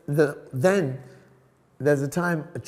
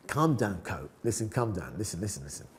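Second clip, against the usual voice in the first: softer, lower, soothing; calming down; 6 semitones lower, 7 dB quieter than usual. Retold or imitated. Tutor calming an aggressive student, soft and soothing. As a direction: settle him down gently.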